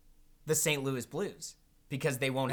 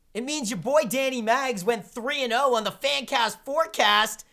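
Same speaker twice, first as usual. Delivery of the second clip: gloating; 9 semitones higher, 8 dB louder than usual. Real, not acted. Gloating over winning the forecast.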